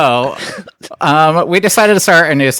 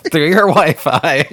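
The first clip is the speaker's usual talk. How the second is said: high laughing, slow words; laughing through the punchline; 9 semitones higher, slower than usual. Real, not acted. Co-host laughing about a Wi-Fi break-in. Cracking up at joke.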